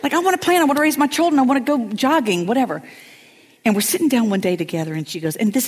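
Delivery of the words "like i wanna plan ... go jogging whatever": mock exasperated protest. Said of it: "And I'm like".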